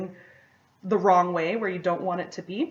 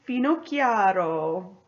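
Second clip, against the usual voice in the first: slow, drawn-out mock Italian accent; playful try at an Italian accent; attempted Italian accent; slower than usual. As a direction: give it your best amateur Italian accent. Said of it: "That's my best Italian accent".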